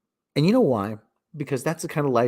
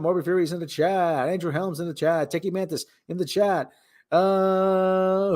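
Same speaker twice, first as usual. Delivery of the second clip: announcer roll call; 7 semitones higher than usual. Acted. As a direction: call out each name like a radio host. Announces chat names.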